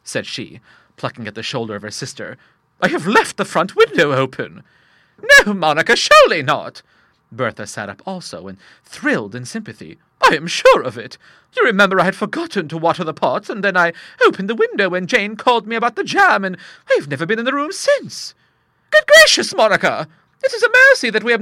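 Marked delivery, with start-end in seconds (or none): 2.8-4.6 high, loud fretful confession; worried about the open window; anxious old lady. Acted. Monica telling Bertha the window is open, anxious. 5.5-6.8 loud, high disbelief; alarmed old sister. Acted. Bertha answering Monica in high alarm. 10.2-18.3 high, loud, rising worry; nervous explaining, getting higher; fretful old lady. Acted. Monica explaining to Bertha, anxious and high.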